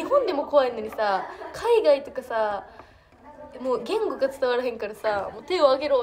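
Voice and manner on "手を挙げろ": a louder mock command; mock hijacker order. Imagining a hijacker abroad, mock loud command. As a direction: bark it like a hijacker, playfully. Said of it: "って言われてても".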